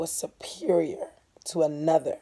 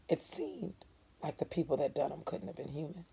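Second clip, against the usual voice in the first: much softer, rushed hush; 11 dB quieter than usual. Acted. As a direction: let it fall to a near whisper. Narration hushed.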